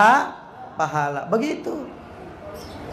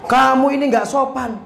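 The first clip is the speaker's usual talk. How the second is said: high-pitched mock scolding; retold scolding; 6 semitones higher than usual. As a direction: imitate an indignant father telling off his child. Father scolds child in story. (menirukan bapak memarahi anaknya)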